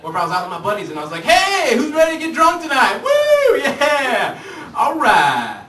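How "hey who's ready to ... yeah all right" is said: very high, loud party whoop; party hype. Pretend. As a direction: whoop it up for the crew. Buddy hyping a night out.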